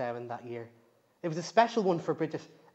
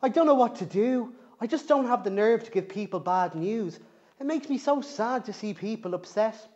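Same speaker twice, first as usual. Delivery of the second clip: put-on higher, small apologetic girl voice; sorry and sad; 6 semitones higher, 4 dB louder than usual. Young banshee apologises sadly to her granny. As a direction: sigh it out, ashamed. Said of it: "Bridget sighed with her head in her hands".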